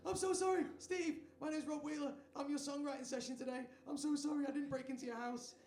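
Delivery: high-pitched, flustered apologizing; panicked apology. Retold or imitated. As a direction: blurt it like a caught intruder pleading innocence. Acted panicked apology to angry homeowner.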